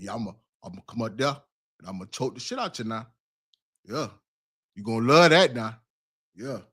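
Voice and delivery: teasing impression, murmured low; Shannon. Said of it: "Shannon choking shit, man".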